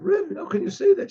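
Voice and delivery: high, crying protest to the Rebbe; tearful plea. Retold or imitated. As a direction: plead through tears, voice shooting up high. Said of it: "She started weeping and crying".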